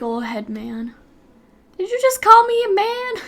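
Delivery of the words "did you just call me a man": high, loud pretend offence; mock outrage. Streamer reacting to being called a man, mock-outraged squawk. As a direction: act affronted, pitched way up and loud.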